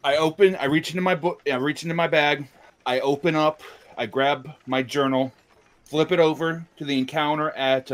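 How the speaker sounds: excited. Acted. Player describing action excitedly.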